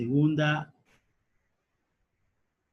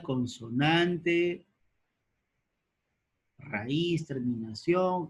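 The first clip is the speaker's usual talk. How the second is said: slow dictation; slower than usual. Shown as a demonstration.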